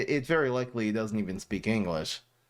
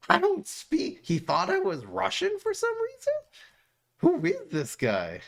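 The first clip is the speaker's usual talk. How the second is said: high, incredulous squeak of confusion; can't believe he thought that; baffled disbelief. Real, not acted. Streamer baffled by a chatter yelling in Russian, high disbelief.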